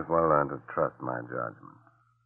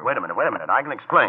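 rising high, louder plea; panicked; 8 semitones higher, 5 dB louder, much faster than usual. Acted. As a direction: scramble to talk your way out. Caught, pleading with agent.

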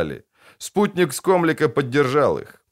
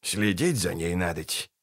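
low mutter; 5 semitones lower, 8 dB quieter than usual. A narrator's reading. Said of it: "ловчий указал".

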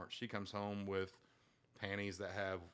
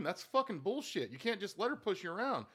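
dad scolding son; 10 semitones higher, 3 dB louder than usual. Retold or imitated.

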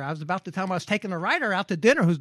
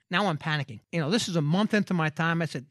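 ordinary talk, nothing marked

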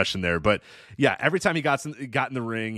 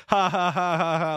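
spoken fake evil laugh, a bit higher; hammed-up heel cackle, said not laughed; lightly voiced gloating ha-ha; mock villain laugh; 5 semitones higher than usual. Mock villain laugh after reveal.

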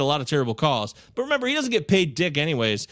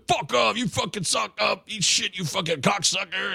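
higher, shouted abuse at the umpire; mock angry yell; 4 semitones higher than usual. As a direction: bark it like a furious old player. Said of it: "he's telling the ump to".

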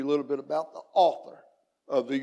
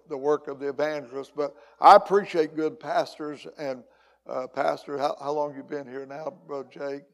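usual speaking voice

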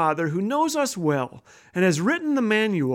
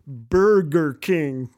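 slow, drawn-out words, a little lower; very slow pronunciation; 4 semitones lower, much slower than usual. Acted. Employee spelling it out for arguing couple, very slow. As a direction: say it very slowly, syllable by syllable. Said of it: "pronounce the name of this place very slowly".

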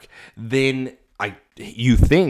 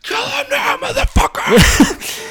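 high, slow screaming rage; furious scream; 5 dB louder, slower than usual. Acted. Ghost screaming at Clark.

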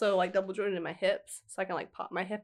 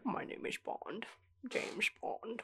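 slow breathy Connery Bond, much softer; Bond imitation; 3 semitones higher, 9 dB quieter, much slower than usual. Friend copying his Bond line, slow and breathy. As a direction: chew the words like Connery with something in the mouth. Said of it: "you're almost chewing it".

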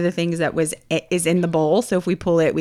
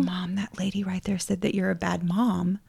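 hushed daughter telling mom; retold in a soft whisper; imitated tattling whisper; 8 dB quieter than usual. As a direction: whisper it as a confidential report.

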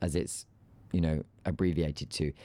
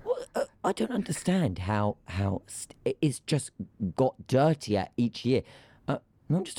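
exasperated rant; 7 semitones higher than usual. Real, not acted. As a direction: vent it in disbelief. Exasperated rant, high and loud.

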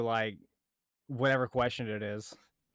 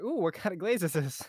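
curious victim; 6 semitones higher than usual. Pretend.

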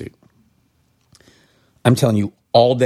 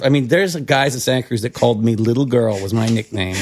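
usual speaking voice

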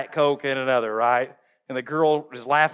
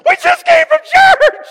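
put-on very high, loud kid voice; mock children's shriek; 12 dB louder than usual. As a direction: squeal it like kids from the back seat. Voicing kids in back seat.

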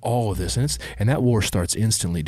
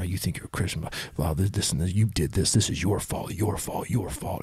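hissed whisper of a tormenting inner voice; whispered accusing voice. Retold or imitated. Man voicing the accusing voice in his head in a whisper. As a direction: whisper the accusations like a voice in your ear.

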